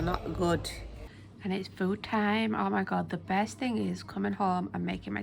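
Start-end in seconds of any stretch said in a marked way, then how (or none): none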